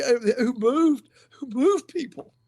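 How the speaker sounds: choked up and holding back tears; teary. Real, not acted. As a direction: voice breaking up with tears. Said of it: "I get teary".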